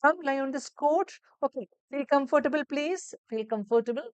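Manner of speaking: high, slow and soothing; caring nurse voice. Shown as a demonstration. Nurse welcoming patient onto the couch, soothing. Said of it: "That intonation you need to focus on".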